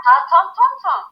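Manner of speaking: "Parton ton ton" high and emphatic with a nasal "ton"; French model line. Shown as a demonstration. Teacher models the closing line for pupils to echo, in French. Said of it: "You copy me, I'll say it".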